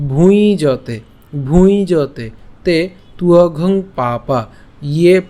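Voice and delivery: "ভুঁই জতে" drawn out, each following word separated; slow word-by-word "তুয়ঘং পাপা ইয়ে", long vowels; pure Sanskrit pronunciation. Shown as a demonstration. Teacher goes through the broken-up verse, drawn-out "ভুঁই জতে". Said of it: "উচ্চারণটা কেমন হচ্ছে".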